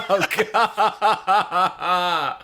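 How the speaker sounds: high laughing groan. Real, not acted. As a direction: laugh out the words. Laughing at the letter.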